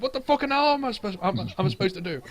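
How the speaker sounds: fast, high angry retort; angry argument. Retold or imitated. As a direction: yell back fast. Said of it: "It's like".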